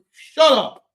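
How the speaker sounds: loud laughing retort. Real, not acted. Fan shouting down the owner's excuses, loud and laughing. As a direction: yell it back through a laugh.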